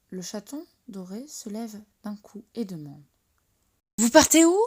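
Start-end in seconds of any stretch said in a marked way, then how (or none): 4.0-4.6 much louder and higher, a sudden eager blurt; loud blurted question. A narrator's reading. Golden kitten waking up and blurting to the others, too loud. Kitten jumps up asking loudly.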